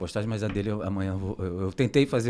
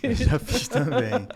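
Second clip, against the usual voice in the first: high, drawn-out words through laughter; laughing; 13 semitones higher, 5 dB louder, slower than usual. Real, not acted. Co-host admitting he has recorded audios too, laughing. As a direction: laugh while owning up to it.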